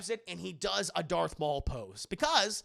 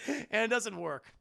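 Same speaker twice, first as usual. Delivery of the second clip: ordinary talk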